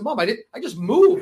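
pleading to mom. Retold or imitated. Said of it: "I said".

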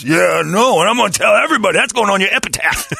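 high, loud mock boasting; gloating boyfriend impression. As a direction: proclaim it proudly, hammed-up holler. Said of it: "And he's just laughing".

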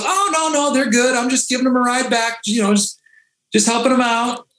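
high, relaxed reassurance; easygoing sheriff. Retold or imitated. Sheriff assures guard the sailors are fine, relaxed and high. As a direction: wave off the concern.

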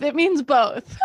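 said through a laugh. Real, not acted. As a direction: repeat the joke while laughing. Repeats it, laughing.

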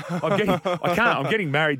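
laughing through the punchline; real laugh breaking into the words. Real, not acted. Host telling the physio story on air, laughing.

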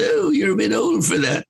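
high, prim put-on voice; mock English lady. English woman telling Casey he's too old, high mock voice. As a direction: tease him in a high lady's tone.

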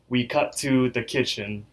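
ordinary talk, nothing marked

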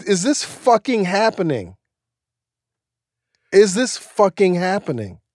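high, slow, drawn-out disbelief; incredulous. Retold or imitated. Applicant hears he will get in, incredulous. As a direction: let each word stretch in amazed disbelief. Retold reaction to incredible good news.

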